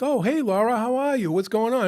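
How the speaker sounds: high, sing-song mock-friendly hello; feigned casual greeting. Playing along as he greets the cop, high feigned friendliness. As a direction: greet her in a high, put-on chummy voice.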